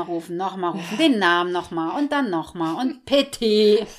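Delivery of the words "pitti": drawn-out, louder name call; mock dog call. Trainer mimics owner repeating the dog's name, mock calling. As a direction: imitate an owner calling yet again.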